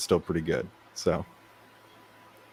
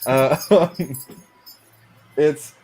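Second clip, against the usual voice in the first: laughing; 6 semitones higher, 3 dB louder, much slower than usual. Real, not acted. Embarrassed about his logo.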